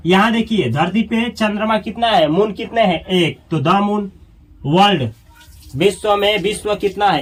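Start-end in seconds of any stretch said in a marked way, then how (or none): none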